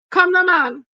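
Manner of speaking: high, loud come now man; exasperated outburst. Real, not acted. Begging the viewers to be real, loud and high. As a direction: throw up your hands, loud and high.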